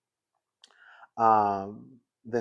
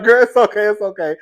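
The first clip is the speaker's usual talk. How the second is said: retold hasty reassurance; 7 semitones higher, 11 dB louder than usual. Retold hurried reassurance to neighbour.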